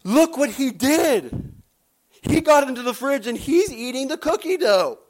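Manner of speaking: put-on girl reporting her brother to her father; little girl telling on brother. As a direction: tell on him like a small girl with a mouthful of dough. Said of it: "with her mouth covered".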